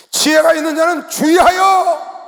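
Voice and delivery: 주의하여 shouted high and loud, slowly; shouted verse. Shown as a demonstration. Pastor driving home the verse to the congregation, shouting. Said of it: "이 말씀을 봐야 된다는 거".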